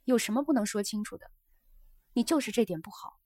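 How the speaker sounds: pointed, mildly scolding retort; mild reproach. Acted. Geisha retorts to her evasive guest, mildly reproachful. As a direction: chide him for dodging.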